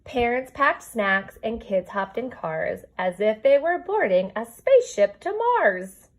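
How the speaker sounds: lilting storybook read-aloud; reading to children. Acted. Teacher reading a rhyming book to her kindergarten class, storybook lilt. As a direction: read the rhyme to your class, gently bouncing.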